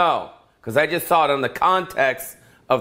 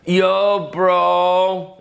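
higher, louder "Yo, bro"; stoner greeting; 6 semitones higher, 3 dB louder than usual. Retold or imitated. Stoner navigation voice greeting the driver, louder. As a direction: greet like a laid-back stoner. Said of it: "they need a voice for the stoners".

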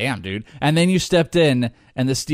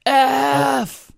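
big yelled F; 10 semitones higher than usual. Pretend. Urging his friend to vent, a mock big yell. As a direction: let out one big F like a caveman. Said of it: "just a big F".

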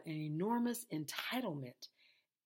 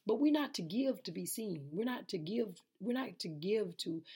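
ordinary talk, nothing marked

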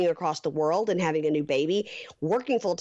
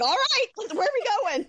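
mock eager Michael. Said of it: "He was like".

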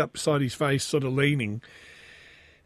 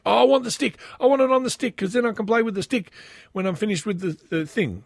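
put-on eager kid; 7 semitones higher, faster than usual. Dad voices his son.

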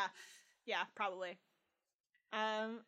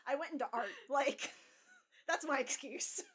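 laughing through the words; giggling while talking; 6 semitones higher, 5 dB louder than usual. Real, not acted. Laughing self-deprecating.

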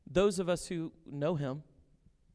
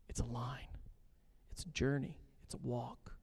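whispered softly; 4 semitones lower, 10 dB quieter than usual. Real, not acted. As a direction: breathe the point out. Preacher softens point.

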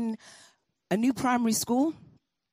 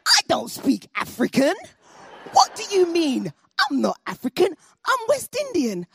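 higher, louder scornful retort; indignant denial; 9 semitones higher, 5 dB louder than usual. Retold or imitated. Rita denying Ayodele in front of friends, higher and louder scorn. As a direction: deny it with offended scorn. Girl denies being African.

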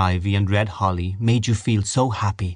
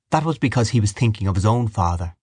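usual speaking voice

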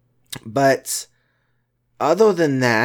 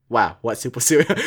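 ordinary talk, nothing marked

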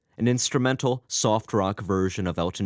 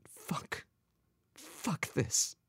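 breath-only defeated curse; whispered to himself; 8 semitones higher, 11 dB quieter, much slower than usual. A narrator's reading. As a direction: whisper the swear under your breath. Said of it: "Albert whispered to himself".

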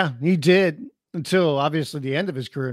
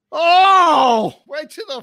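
sudden loud pained cry, pitched way up; shocked cry; 9 semitones higher, 5 dB louder, slower than usual. Real, not acted. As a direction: yelp in real shock. Wrestler misses top rope, falls.

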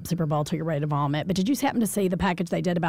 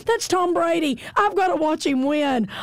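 put-on high, insistent child's plea; excited little boy; 8 semitones higher, slower than usual. Six-year-old to his babysitter about Brady, high excited pleading. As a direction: plead like a kid up past bedtime, pitched high.

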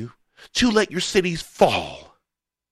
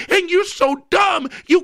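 raised, exasperated voice; shouting; 10 semitones higher, 3 dB louder than usual. Real, not acted. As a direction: yell it in frustration. Shouting at opponents.

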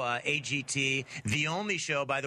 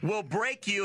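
ordinary talk

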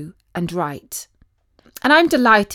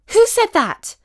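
high, louder startled question; 12 semitones higher, 5 dB louder than usual. Acted. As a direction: spin round and demand it in alarm. Startled girl hears mysterious voice.

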